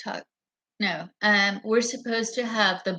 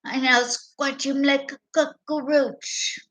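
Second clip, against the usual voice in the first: higher, slow sneering imitation; lightly voiced gloating politician; mock villain voice; 5 semitones higher than usual. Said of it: "She's like".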